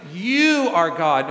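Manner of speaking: "you" long and loud, falling after; stress on YOU. Acted. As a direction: make "you" the big slow word. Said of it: "why the emphasis on the word".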